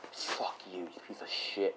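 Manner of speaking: whispered, tired insult; spent cursing. Acted. Deku getting off 'cause he's tired, spent whispered curse. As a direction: curse under your breath after a sigh.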